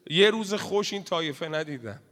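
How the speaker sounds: ordinary talk, nothing marked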